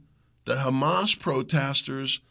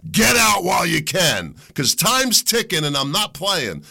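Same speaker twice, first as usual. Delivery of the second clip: louder, yelled ultimatum; shouted warning; 2 semitones higher, 4 dB louder than usual. Real, not acted. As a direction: bark it like a final warning. Warning to communists.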